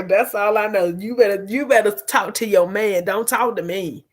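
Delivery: normal talking voice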